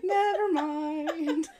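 high, loud, drawn-out mock dismissal; exaggerated, long-suffering brush-off; mock martyr. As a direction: draw it out high for comic effect. Mock self-sacrifice, played for laughs.

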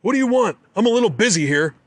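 high, loud, annoyed yell; hollering. A narrator's reading. Hunter hollering at the rangers, loud and annoyed. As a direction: bark it up high over the chopper. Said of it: "I holler".

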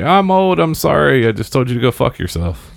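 high-pitched fake apology, falling as it goes; hammed-up elderly excuse; mock old person. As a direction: play the unapologetic old-timer, starting way up high. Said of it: "walk around and say".

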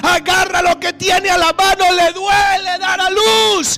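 high, loud, crying-out sermon; preaching at full force. Acted. Preacher voicing Paul to the brethren, loud impassioned shout. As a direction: cry out like birth pains for the church. Preacher acting Paul's birth pains.

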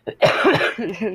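real burst of giggling; laughing at herself. Real, not acted. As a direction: break into giggles at your own story. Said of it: "sorry, I'm laughing at myself".

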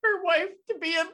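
high, loud, breaking into laughter; can't get it out for laughing. Real, not acted. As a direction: laugh through the words. Cracking up while telling it.

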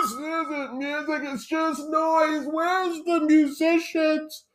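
high, slow grumpy grandpa voice; cranky old grandfather scoffing; old man voice. Retold or imitated. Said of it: "like an eighty-year-old grandfather".

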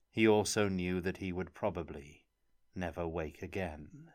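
plain reading voice

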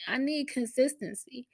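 ordinary talk, nothing marked

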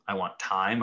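normal talking voice

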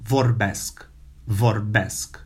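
vorbesc with a rolled r and final sk; Romanian vorbesc. Shown as a demonstration. Teacher models vorbesc for students. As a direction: say vorbesc, stress on the last syllable. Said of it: "Try to say, I speak".

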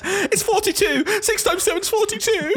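very high, slow, laughing squeak; squeaky kid. Retold or imitated. Teacher voicing a child answering a sum, squeaky and laughing.

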